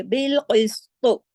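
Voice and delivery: slow melodic recitation of बिल क़िस्तो; Quran recitation. Shown as a demonstration. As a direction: chant बिल क़िस्तो with heavy क़ and त. Recites verse phrase.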